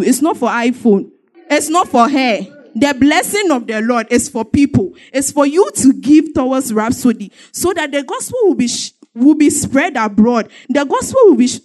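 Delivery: testimony turning into full sermon; preaching. Acted. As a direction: preach it to the congregation with fire. Preaching builds up.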